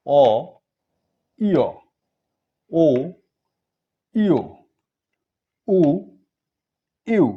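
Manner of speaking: each vowel from আ to উ loud and separate, long pauses between; emphatic vowel-by-vowel recital, last উ as unrounded "eu"; pronunciation drill. Shown as a demonstration. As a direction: model the vowels for learners to repeat, ইয়া gliding from "i" to "a". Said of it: "আবার আমাদের সাথে একবার উচ্চারণ করেন".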